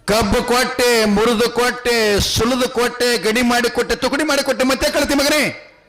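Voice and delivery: high-pitched, exasperated tirade; acted fury of a father at his wit's end; angry king. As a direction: climb into a scolding list of everything done. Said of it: "ರಾಜಾಗ ಸಿಟ್ಟು ಬಂತು".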